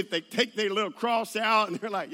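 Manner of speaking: ordinary talk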